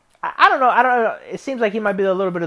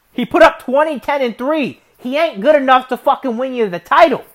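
heated rant; 6 semitones higher, 6 dB louder than usual. Real, not acted. Ranting about the rookie.

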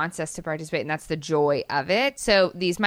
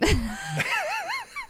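high laugh; 4 dB quieter than usual. Real, not acted. Bursts out laughing.

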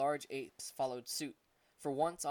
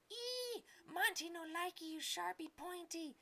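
very high, slow squealing monkey voice in broken English; yelling little monkey; slower than usual. Acted. Trapped monkey Monty yells at Artius about his knife, in a very high monkey squeal. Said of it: "it yelled".

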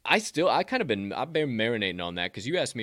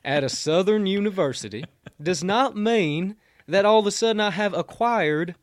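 mock Southern accent; 7 semitones higher, 4 dB louder than usual. Podcast host denying his new Southern accent, in a mock Southern twang. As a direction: protest in a put-on Southern twang. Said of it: "a fake Southern accent".

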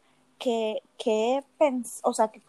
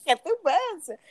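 laughing; 4 semitones higher than usual. Real, not acted.

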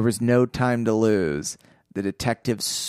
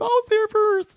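squeaky voice; 4 dB louder than usual. Retold or imitated.

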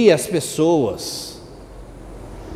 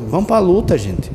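ordinary talk

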